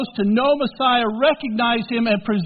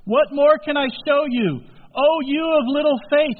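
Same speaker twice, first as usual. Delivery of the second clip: higher, louder weary rebuke; exasperated rebuke; 7 semitones higher, 4 dB louder than usual. Acted. Jesus to his doubting disciples, exasperated rebuke. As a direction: sigh it out like a let-down teacher.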